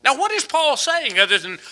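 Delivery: ordinary delivery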